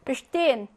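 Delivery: "Bestehen" stressed on STE, Be unstressed; second-syllable stress. Shown as a demonstration. Teacher drilling inseparable verbs, "Bestehen" stressed on STE. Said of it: "이 강세".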